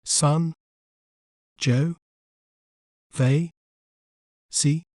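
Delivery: "Sun. Jo. Ve. Si." one syllable at a time, long pauses; isolated, evenly spaced syllables "Sun. Jo. Ve. Si." in British pronunciation; British syllable breakdown. Shown as a demonstration. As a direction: spell out British "Sun. Jo. Ve. Si." by sound, ending in -ee. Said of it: "In British accent".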